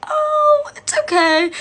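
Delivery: very high, drawn-back placating voice; polite brush-off. Acted. Storyteller declining a stranger's message, high placating voice. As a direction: squeak out a falsely easy reassurance.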